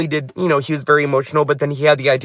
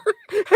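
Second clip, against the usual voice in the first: laughing; 6 dB quieter than usual. Real, not acted. Laughs after quoting herself.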